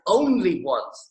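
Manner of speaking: slow "only once", "only" punched hard; stress on only once. Retold or imitated. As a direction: drag out "only once" as if underlined. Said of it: "in big capital letters".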